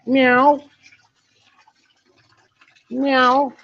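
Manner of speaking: high mock cat meow. Talking to the bird, a high cat meow. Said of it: "He meows like a cat".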